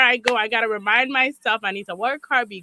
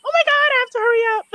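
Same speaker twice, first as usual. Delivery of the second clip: panicked rush; 12 semitones higher, 3 dB louder, much faster than usual. Retold or imitated. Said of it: "This morning I was like".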